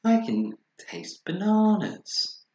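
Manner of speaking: high, slow amazed exclaim; surprised. Acted. Bear marvels at the taste of the rainbow, high and slow. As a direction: savour the wonder slowly.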